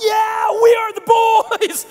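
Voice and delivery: very high, very loud whooping chant; full-throated, hammed-up war cry; retold triumphant yell. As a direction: yell it out like a kid cresting a coaster hill. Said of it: "No idea why I yelled it".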